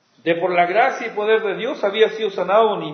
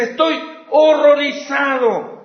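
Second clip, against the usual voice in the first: slower, louder, higher cry of dismay; horrified apostle; 6 semitones higher, 6 dB louder than usual. Retold or imitated. Paul writing to his church, horrified and slowed. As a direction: voice Paul's shock to the church. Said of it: "el apóstol está aturdido".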